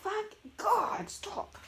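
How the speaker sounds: high, loud exasperated swearing; angry. Real, not acted. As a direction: beg the sneezing to stop, high and cross. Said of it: "I'm getting angry".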